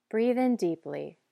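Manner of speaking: ordinary delivery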